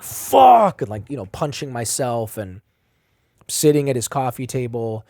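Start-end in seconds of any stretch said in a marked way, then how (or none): 0.0-0.8 sudden high, loud cry of Fuck; anguished shout. Retold or imitated. Crying alone in the house, a sudden anguished Fuck. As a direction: blurt it out in despair. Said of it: "I started crying, and I'm just like".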